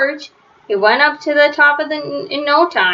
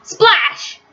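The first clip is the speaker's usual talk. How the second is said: big splash; 8 semitones higher than usual. A narrator's reading. George falls into the lake.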